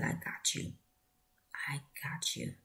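hushed, tender whisper; reassuring whisper. Retold or imitated. Sister lifting her sick brother, soft whisper. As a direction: soothe him softly, almost unvoiced.

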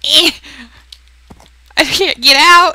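high, loud flustered cry; excited. Real, not acted. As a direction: yelp in frustration. Stuck on horse in game.

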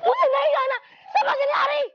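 high, shouted protest; shrieked-up pitch, pushing them away; frantic refusal. Acted. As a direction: yell it in exasperated desperation. Acted quarrel, girl shouts her refusal.

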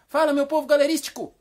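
high, loud, joyful catchphrase; animated, very cheerful. Shown as a demonstration. Host teaches Carlos to greet with animation, cheerful shout. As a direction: greet with bouncy, cheerful energy. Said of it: "Agora fala".

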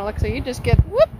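normal talking voice

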